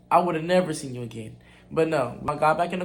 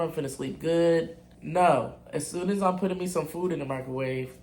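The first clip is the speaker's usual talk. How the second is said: usual speaking voice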